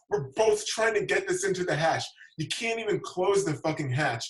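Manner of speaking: ordinary delivery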